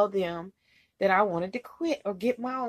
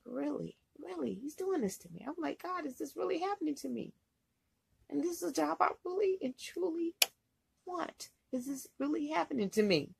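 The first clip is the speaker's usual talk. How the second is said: soft, higher-pitched stunned aside; hushed disbelief; 5 semitones higher, 8 dB quieter than usual. Retold or imitated. Trainee asking whether this is really happening, hushed disbelief. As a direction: drop the volume as if thinking it to yourself in disbelief. Stunned inner thoughts while in tears.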